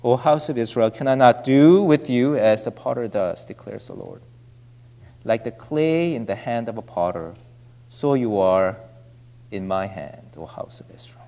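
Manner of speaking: soft, hushed reading that fades to a whisper; solemn scripture reading. Acted. Preacher reading Jeremiah to the congregation, hushed and reverent. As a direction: read the verse reverently, sinking low at the end.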